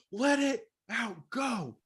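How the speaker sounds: rally them with a big slow shout; shouted urging. Retold or imitated. Coach shouting retreat men on to let it out, loud. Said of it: "I'm like".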